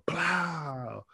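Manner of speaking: high, drawn-out bang imitation; imitated firecracker crack. Wrestler recreating the slap heard across the locker room, firecracker imitation. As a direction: mimic a firecracker going off. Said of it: "like a firecracker".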